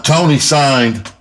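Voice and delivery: ordinary delivery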